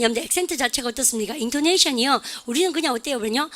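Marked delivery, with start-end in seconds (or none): none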